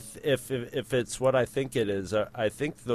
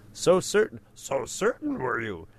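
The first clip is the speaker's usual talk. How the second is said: Yoda; 3 dB louder than usual. Acted. Host quoting Empire from listener mail, Yoda impression. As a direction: quote it as the little Jedi master, dropping back after a raised start. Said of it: "Yoda takes a line from Empire".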